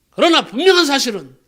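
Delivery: high, loud, emphatic sermon delivery; impassioned preaching. Real, not acted. Preacher assures believers of entering heaven, impassioned preaching. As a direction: proclaim it with full conviction to the congregation.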